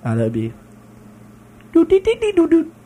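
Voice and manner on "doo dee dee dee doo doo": high electronic startup chime; powering up. Pretend. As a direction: chime like a machine booting. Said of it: "your power up noise".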